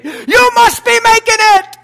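loud, ringing preacher's declaration; full-force preaching. Acted. Pastor declaring to the church, loud. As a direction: hammer it home from the pulpit.

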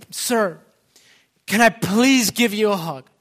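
high, loud, excited request; overjoyed plea. Retold or imitated. Preacher voicing the healed man asking for a hug, overjoyed. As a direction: plead loudly, bursting with gratitude. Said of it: "filled with excitement".

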